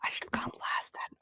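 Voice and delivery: whispered, awkward remark; hushed regret. Acted. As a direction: whisper it, embarrassed. Whispered reply.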